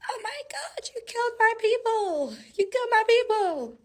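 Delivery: high, drawn wail of outrage; hammed-up, high-pitched lament; upset queen. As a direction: imitate an upset queen wailing. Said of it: "so she was upset".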